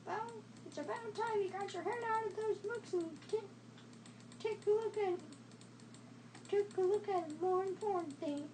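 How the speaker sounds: fast lightly voiced movie reading; acting a scene. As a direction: rattle off the dialogue. Acting Belle and Gaston dialogue.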